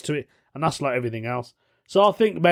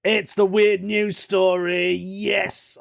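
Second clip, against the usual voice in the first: slow, higher hammed-up host voice; mock announcer; 7 semitones higher, slower than usual. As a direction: announce the segment like a showman. Announcing the favourite segment.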